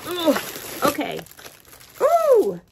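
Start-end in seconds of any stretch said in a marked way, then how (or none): 2.0-2.6 loud high ooh; excited ooh at finding a bag; delighted ooh. Real, not acted. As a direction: squeal of delight. Delight at the gift.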